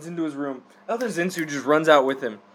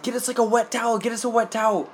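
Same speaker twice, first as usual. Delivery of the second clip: retold yelling; 7 semitones higher, faster than usual. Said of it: "everybody starts yelling".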